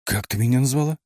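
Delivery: mildly indignant, high-pitched question; indignant bark. A narrator's reading. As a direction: snap back, taken aback and offended. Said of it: "возмущённо гаркнул я в трубку". (возмущённо, повысив тон)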